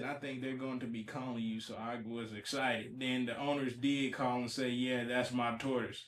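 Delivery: very soft, low read-aloud; reading a quote aloud. Acted. Host reading the owner's account, very soft low reading. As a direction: read it out under your voice.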